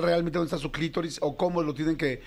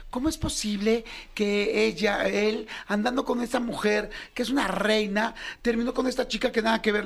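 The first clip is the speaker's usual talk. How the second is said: incredulous friend; 7 semitones higher than usual. Retold or imitated. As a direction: ask it amazed and baffled. Friends asking in quoted voice.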